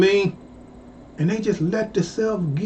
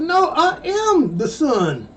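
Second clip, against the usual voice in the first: put-on pitched-up protest to old classmates; insistent correction; 7 semitones higher than usual. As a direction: make them remember you. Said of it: "I said".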